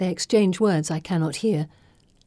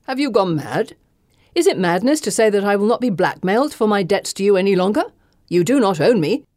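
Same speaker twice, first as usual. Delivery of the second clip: put-on an older gentleman's voice, rising to a louder defiant retort; indignant old Thompson; 2 semitones higher, 5 dB louder than usual. A narrator's reading. Old gentleman defying his creditor, a louder indignant voice. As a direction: push back hard, refusing to be blackmailed.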